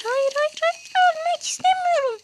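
very high, squealed pleading; mock panic. Streamer getting shot, very high mock panicked pleading. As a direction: beg in playful game panic.

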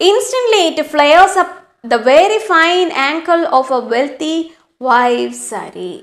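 slow, deliberate reading of the story. A narrator's reading. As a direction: read each phrase slowly for the class. Teacher reads story aloud. (പതുക്കെയുള്ള കഥ വായന)

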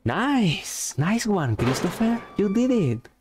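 high, cheering praise; excited cheering. Pretend. Cheering on Chris.

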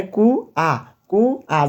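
কু আ with a clear break between কু and আ; two separate beats. Shown as a demonstration. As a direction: sound কু and আ as two slow, separate syllables. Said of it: "দুইবার উচ্চারণ হয়েছে". (কু আ দুই ধাক্কায় আলাদা করে উচ্চারণ)

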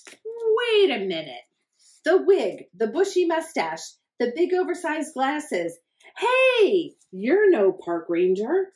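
high, loud alarmed discovery; shocked realization. Acted. Hector realizing the ranger is the duck, high and alarmed. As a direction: gasp out the discovery.

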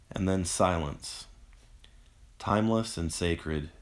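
plain reading voice

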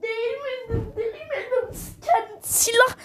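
high, soft, laughing protest; laughing whimper. Real, not acted. Gamer insisting he never touched it, laughing high and soft. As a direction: squeak it out through a helpless laugh.

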